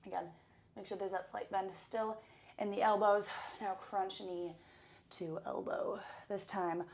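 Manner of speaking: loud, fast workout coach; fitness instructor. Acted. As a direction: pump up the class. Leads a workout warm-up.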